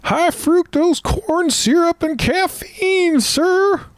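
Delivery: very high, slow whiny private; meek recruit voice. Acted. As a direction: squeak the reply like a scared recruit. Recruit answers sergeant.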